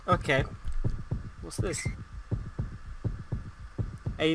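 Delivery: ordinary talk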